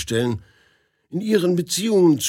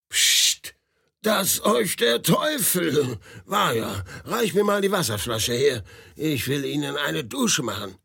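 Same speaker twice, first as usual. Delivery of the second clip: cursing the cats; 5 semitones higher than usual. Acted. Aljoscha curses the cats.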